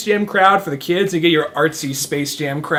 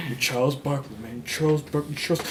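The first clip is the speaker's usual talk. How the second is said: softer self-talk to the mirror; lightly voiced brooding athlete; mild hushed pep talk; 3 semitones lower, 8 dB quieter than usual. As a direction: murmur to yourself, a little mock intense.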